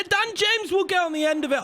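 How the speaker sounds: high, rising commentator call; excited call. Real, not acted. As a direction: call it as the chance opens. Anticipating a chance in game.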